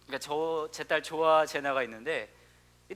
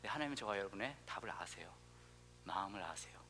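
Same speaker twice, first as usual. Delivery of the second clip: ordinary talk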